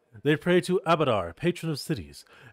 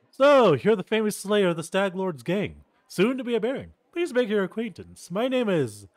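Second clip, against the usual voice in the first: lightly voiced eager young noble, higher; a polite young aristocrat, pitched up; young nobleman voice; 7 semitones higher, 3 dB louder than usual. Young noble introduces himself.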